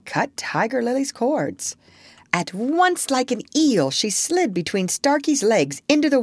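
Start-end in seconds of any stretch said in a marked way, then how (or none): none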